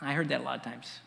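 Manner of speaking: usual speaking voice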